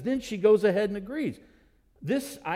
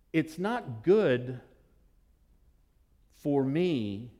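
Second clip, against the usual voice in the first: gentle explanation; 3 semitones lower than usual. Acted. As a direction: explain it kindly, taking your time. Jesus gently turns to her.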